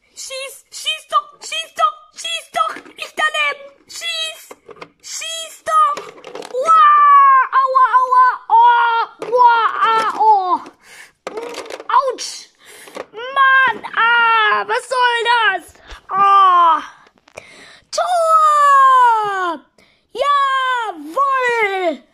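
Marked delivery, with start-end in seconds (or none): 0.1-6.0 high, chanting cheer urging a shot. Acted. Fan urging the player on TV, high chanted cheer. 6.5-10.6 loud ouch cries, pitch up; pain yelps. Acted. Hit by the ball, loud pained yelping. 17.9-22.0 loud, high shout of joy; goal cheer. Acted. Fan celebrating the goal, loud high shout.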